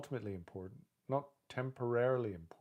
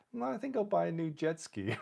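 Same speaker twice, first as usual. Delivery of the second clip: mock carefree; 8 semitones higher than usual. Mocking blasé people's flippancy.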